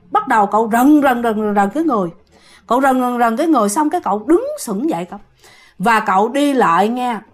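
plain reading voice

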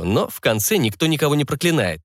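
ordinary delivery

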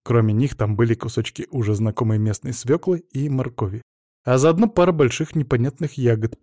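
usual speaking voice

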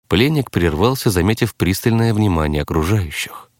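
usual speaking voice

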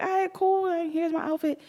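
high, breezy eager-to-please voice; mock eager compliance. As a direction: chirp it like you're happy to comply. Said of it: "They're like".

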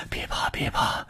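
soft whisper close to the ear; soothing whisper. Acted. Rescuer carrying the fainting patient, whispering comfort in the ear. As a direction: whisper reassurance to someone frightened. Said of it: "凑在他的耳边说".

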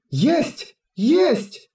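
high, snappy eager reply; eager yes. Acted. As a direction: answer orders with high eagerness. Twins answer orders eagerly.

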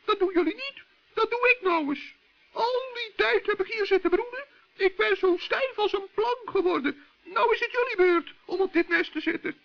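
a high, busy bird taking over; high-pitched, brisk bird giving orders. Acted. Other bird takes charge.

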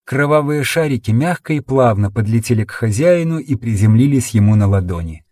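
plain reading voice

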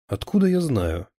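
taken aback by the question; surprised. A narrator's reading. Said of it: "удивился Шурыгин".

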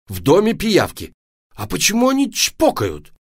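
loud, high outburst of outrage; indignant. Acted. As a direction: exclaim like an appalled dad. Папа возмущён пиявками в доме.

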